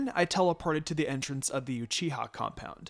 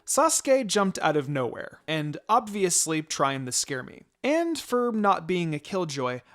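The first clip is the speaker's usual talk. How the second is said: usual speaking voice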